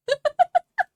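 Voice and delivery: a loud, high burst of laughter; shrieking laugh. Real, not acted. Streamer bursts out laughing at chat, a loud high laugh. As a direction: squeal out a laugh.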